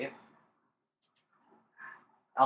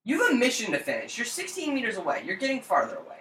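mock nagging reminder; 6 semitones higher than usual. Game warns player to finish the mission, mock nagging. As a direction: recite it like a nagging game alert. Mimics game's warning message.

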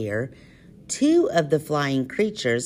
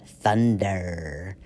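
deep dramatic voice; 8 semitones lower than usual. Acted. Secret word revealed dramatically.

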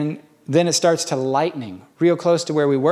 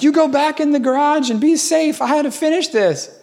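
stubborn boss; 12 semitones higher, 3 dB louder than usual. Retold or imitated. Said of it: "So then he's like".

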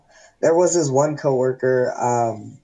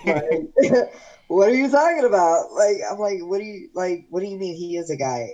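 retold bafflement, pitched up; baffled; 6 semitones higher than usual. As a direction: act out not understanding her at all. Retold baffled reply to coworker.

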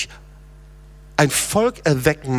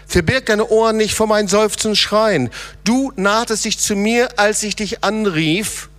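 fervent preaching; 9 semitones higher, 3 dB louder than usual. Acted. Pastor urging the church to cry to the Lord, fervent preaching. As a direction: preach it, calling them out of the pit. Preacher at full force again.

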